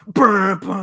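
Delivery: loud, high brassy "brr"; imitated theme noise. Retelling Electro's eyes opening, loud imitated theme blast. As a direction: blare the theme with the lips. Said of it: "his eyes open, he's like".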